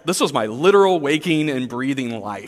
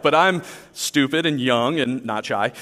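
normal talking voice

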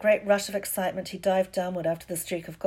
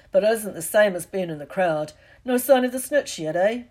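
Hagrid; 9 dB louder than usual. Acted. Hagrid chats in the stands.

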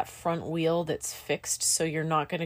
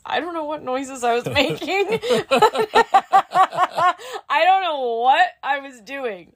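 high, loud, breaking into laughter; laughing in baffled embarrassment; 10 semitones higher, 8 dB louder than usual. Real, not acted. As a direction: crack up helplessly while talking. Laughing while admitting her panic noises.

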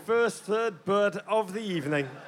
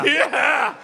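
loud triumphant yeah; cheering shout; 7 semitones higher, 8 dB louder than usual. Acted. Cheering for Jim.

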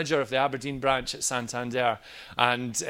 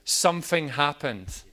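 normal talking voice

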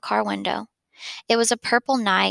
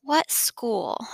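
breathy near-whisper, barely voiced; hushed question; 2 semitones higher, 4 dB quieter than usual. Boy whispers his question to his parents. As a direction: ask it under your breath, dreading the answer.

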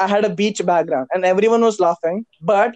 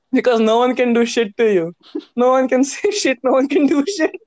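usual speaking voice

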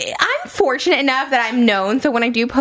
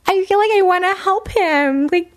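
high, cooing sympathy; drawn-out, high-voiced mock sympathy; mock pity; 9 semitones higher, slower than usual. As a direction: pity him like a hopeless baby, pitched way up. Mock-pitying the bad dirty texter.

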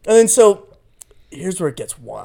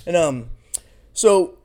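normal talking voice

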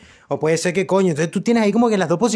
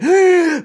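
sharp gasp; 11 semitones higher, 7 dB louder than usual. Acted. Mock gasp of regret.

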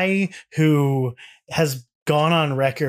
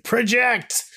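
mock frustrated scream; 9 semitones higher than usual. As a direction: imitate an exasperated producer calling out. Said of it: "a very frustrated producer screaming".

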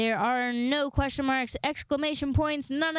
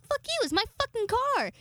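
much higher, mock-indignant snap back; mock angry retort; 10 semitones higher than usual. Retelling snapping at friend over music, mock angry. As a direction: replay telling him off, hammed up. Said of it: "So I'd be like".

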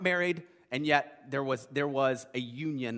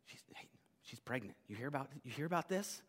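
hushed mock gossip, much softer; 3 semitones lower, 10 dB quieter than usual. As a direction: leak a scandal under your breath. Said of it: "word would kinda start to leak out".

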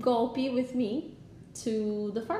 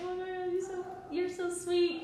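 much higher, softer gushing; 8 semitones higher, 5 dB quieter than usual. Retold or imitated. Thanking boyfriend for his gift, gushing high. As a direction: coo it sweetly. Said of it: "I was like".

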